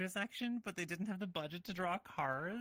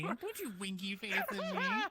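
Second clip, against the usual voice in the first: slow, higher, laughing scold; mock scolding; 6 semitones higher than usual. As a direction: tease them, wagging a finger. Playful scolding at a chat winky.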